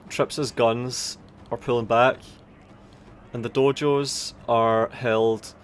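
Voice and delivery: ordinary delivery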